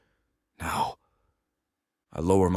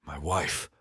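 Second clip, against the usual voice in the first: much softer, tender echo of the word; softly savoured; 3 semitones lower, 6 dB quieter than usual. A narrator's reading. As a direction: let the word sink in, hushed. Narrator savours the word again.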